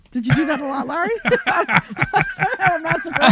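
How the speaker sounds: words spilling out through a laugh. Real, not acted. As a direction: laugh while teasing him. Teasing host after sex-line joke.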